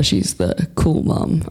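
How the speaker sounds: normal talking voice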